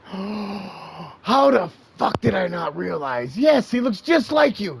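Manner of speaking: loud, high, slowed exclaiming; excited realization. Retold or imitated. Retelling the bartender's shocked realization, loud excited. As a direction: act out the stunned guy remembering.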